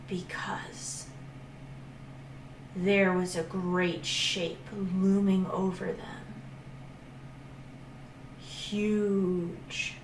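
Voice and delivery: slow, soft, near-whispered telling; hushed suspense. A narrator's reading. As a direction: draw the listener into the dark.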